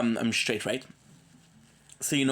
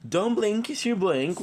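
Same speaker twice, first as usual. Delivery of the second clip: energetic intro; 8 semitones higher than usual. Real, not acted. Host opens the show with a punchy catchphrase.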